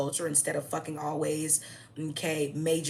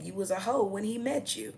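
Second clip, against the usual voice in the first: normal talking voice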